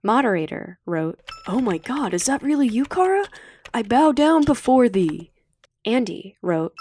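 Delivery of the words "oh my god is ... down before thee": hammed-up gushing, rising higher and louder; playfully worship her; mock awe. Moderator gushes over Kara.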